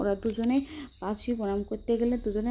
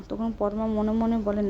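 plain reading voice

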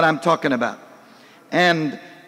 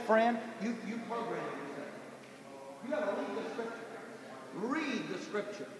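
quiet; 2 semitones higher, 19 dB quieter than usual. Real, not acted. Sermon point drops low.

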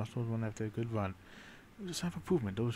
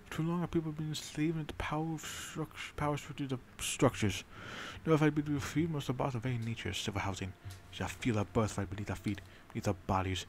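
rousing speech; 4 semitones higher than usual. Acted. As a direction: stir up the crowd with it.

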